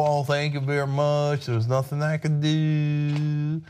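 drawn-out helpless dope voice; mock spineless politician. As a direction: play a feeble pushover giving up. Mocks the governor meekly giving in.

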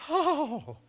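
loud, high "oh" of pain; re-enacted shock cry. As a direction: cry out as if jolted by the fence. Re-enacts electric shock from fence.

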